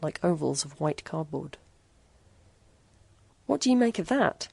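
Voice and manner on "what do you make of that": ordinary delivery, nothing marked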